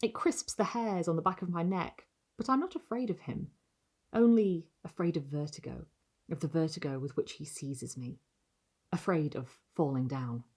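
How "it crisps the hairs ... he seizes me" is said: plain reading voice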